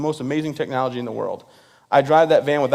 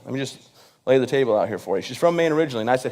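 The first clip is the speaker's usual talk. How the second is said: normal talking voice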